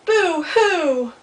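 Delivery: high, loud wailed boo-hoo; loud mock crying. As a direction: wail it out like an upset storybook beaver. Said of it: "it gets louder and louder and louder".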